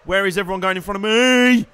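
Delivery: high, loud protest to the other drivers; exasperated outcry. Real, not acted. Losing places at race start.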